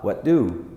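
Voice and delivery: "What do" joined as "whaddo", low; merged t and d. Shown as a demonstration. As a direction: blend the t of "What" into "do". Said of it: "vai juntar esses dois".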